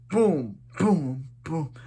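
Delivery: punchy boom boom boom, punching the air; mock punch sounds. Recapping the fight, punch noises. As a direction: thump out fight noises like a kid.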